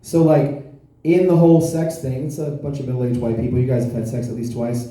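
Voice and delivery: usual speaking voice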